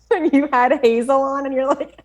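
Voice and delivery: high-pitched, laughing through the words. Real, not acted. Teasing co-host about an accent slip, laughing. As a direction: talk through real giggles. Laughing while recalling co-host's guest.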